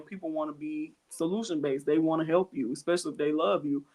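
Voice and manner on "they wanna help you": ordinary talk, nothing marked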